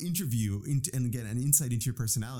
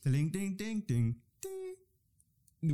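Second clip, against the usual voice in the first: put-on high, sing-song Indian accent jingle; 7 semitones higher, much slower than usual. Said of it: "You made it in an Indian accent".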